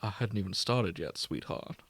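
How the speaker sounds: soft, sultry purr trailing into a whisper; hammed-up seductive purr.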